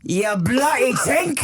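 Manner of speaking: high, slow British jeer; drawn-out, high mock-British voice; mock British accent. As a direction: ham up a British insult. Hammed-up British banter.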